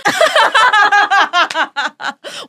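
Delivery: loud high cackling laugh; helpless high-pitched laughing fit; burst of laughter. Real, not acted. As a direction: crack up in a long high peal. Hosts crack up at own intro.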